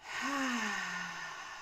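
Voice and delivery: soft long exhale through the mouth; long slow sigh. Shown as a demonstration. Showing the listener a long exhale, a soft sigh. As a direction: let the breath out in a slow sigh. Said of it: "But if you can go".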